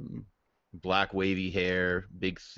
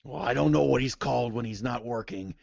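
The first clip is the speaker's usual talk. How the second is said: aggrieved half-orc; 5 semitones higher than usual. Acted. Half-orc complains of debt.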